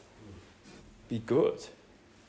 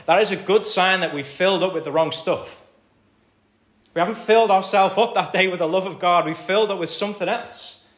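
louder, higher, building sermon cry; preaching at full force; 6 semitones higher, 10 dB louder than usual. Acted. Pastor urging the congregation, preaching at full force. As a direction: drive it home to the congregation.

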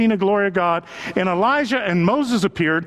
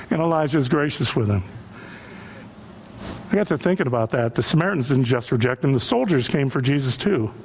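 ordinary talk

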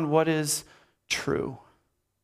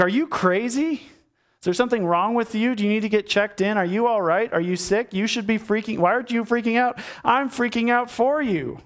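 high-pitched, alarmed questioning; rapid-fire mock disbelief, pitched high; incredulous friend; 6 semitones higher than usual. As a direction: act out a friend freaking out in disbelief.

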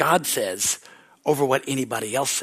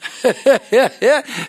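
real laugh; 8 semitones higher, 7 dB louder than usual. Real, not acted. Preacher laughs at his point.

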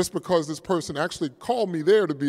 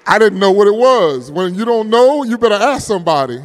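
much louder, higher, rushing the last line; preaching punchline; 6 semitones higher, 12 dB louder than usual. Acted. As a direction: hammer the lesson home.

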